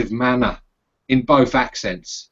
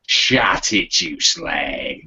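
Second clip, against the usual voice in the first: Sweeney copper; 8 semitones lower, much slower than usual. Retold or imitated. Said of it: "The Sweeney".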